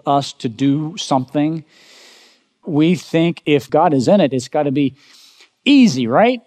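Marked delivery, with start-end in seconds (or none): 5.6-6.4 mock naive. Mocking the easy-faith assumption.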